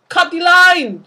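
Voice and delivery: high, loud urgent shout. A narrator's reading. Nyssa ordering the crew to cut the line, shouting. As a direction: yell the order across the deck. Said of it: "shouted Nyssa".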